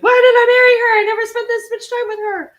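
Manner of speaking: high, loud mock wail of regret; exasperated husband. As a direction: imitate a husband at his wits' end. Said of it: "he's just gonna have to be with me and be like".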